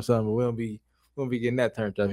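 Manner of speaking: ordinary talk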